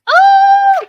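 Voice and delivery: long, high, loud "oh" whoop; delighted whoop. Real, not acted. Podcaster cheering the heroine's petty comeback, delighted whoop. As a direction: whoop like a crowd at a sick burn.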